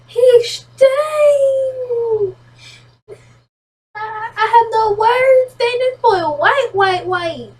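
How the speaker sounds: high, drawn-out exclamation of shock; dumbfounded, stretch the words out high; high-pitched, stumbling astonishment; stunned disbelief. Real, not acted. Shocked reaction to pale character.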